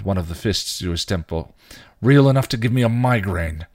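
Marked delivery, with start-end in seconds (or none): 2.0-3.7 louder, higher-pitched exasperation; irritated, forceful complaint; snarled. A narrator's reading. Spike scolds hysterical Dawn, snarling. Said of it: "he snarled".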